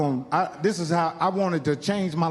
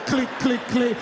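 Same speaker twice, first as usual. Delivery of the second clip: gun clicks; 4 semitones higher, slower than usual. Shown as a demonstration. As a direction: tick out each misfire. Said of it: "it skipped like this".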